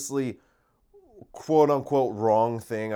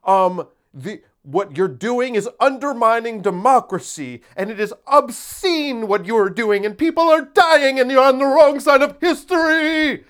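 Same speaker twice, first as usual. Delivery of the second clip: much higher and louder, shrill outrage building; mock outraged critic; 12 semitones higher, 9 dB louder than usual. Mocking a furious online critic, rising hammed-up shout. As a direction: ham up a furious online scold. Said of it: "If someone says".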